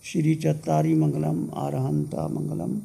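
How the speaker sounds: measured mantra chanting in short, even phrases; prayer recitation. Real, not acted. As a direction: recite a Jain prayer on a steady tone. Recites Jain prayer after meditation. (लयबद्ध मंत्र पाठ)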